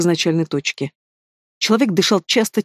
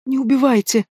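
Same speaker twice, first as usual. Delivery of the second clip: begging hiss; 7 semitones higher than usual. Acted. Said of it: "прошипел мужичок".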